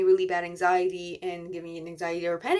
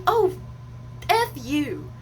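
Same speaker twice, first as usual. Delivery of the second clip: retold angry retort; 6 semitones higher, much slower than usual. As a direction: throw it back in exasperation, retold. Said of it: "I was just like".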